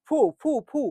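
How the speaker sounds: ফু puffed out with the lips closing each time; demonstrating lips. Shown as a demonstration. Teacher shows how the lips touch on ফু. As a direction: blow out ফু, ফু, ফু through the lips. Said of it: "এই দেখো ফুল".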